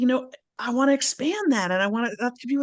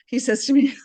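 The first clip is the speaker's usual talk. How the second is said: ordinary talk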